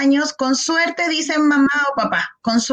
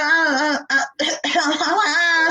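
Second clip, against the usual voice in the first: put-on baby babble ah; 2 semitones higher than usual. Shown as a demonstration. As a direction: coo like a newborn, lightly. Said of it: "parte diciendo".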